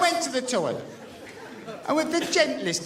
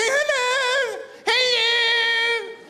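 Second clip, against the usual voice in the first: high, sing-song greeting; high, musical mock hello; sing-song neighbour hello; 12 semitones higher, much slower than usual. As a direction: chirp it like an over-keen neighbour.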